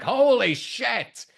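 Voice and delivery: high, slow, stretched-out holy shit; imitating Rex's stunned yell; shocked outburst. Rex shocked as Mark crashes in.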